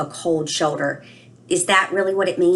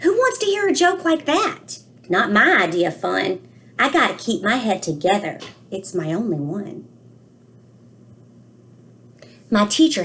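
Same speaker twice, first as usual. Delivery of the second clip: put-on a child's worried storybook voice, read aloud gently; reading to children; 3 semitones higher than usual. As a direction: read it to the class like a puzzled little boy. Reads book aloud, child narrator.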